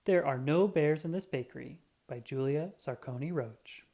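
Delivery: ordinary delivery, nothing marked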